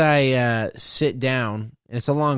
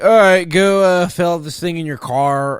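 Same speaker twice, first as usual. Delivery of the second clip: loud tester; 5 semitones higher, 7 dB louder than usual. Retold or imitated. Imitating tester's instructions.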